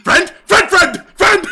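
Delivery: high, loud yapped single words; short high-pitched talking-dog yips; barking dog. Acted. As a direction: bark each word out like an excited dog. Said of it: "they start barking".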